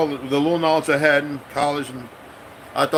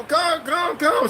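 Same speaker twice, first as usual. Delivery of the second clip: much higher, eager beckoning grandma voice; calling him over; much slower than usual. Retold or imitated. Korean mama beckoning him from the street, high eager call. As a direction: call him in like an excited old lady.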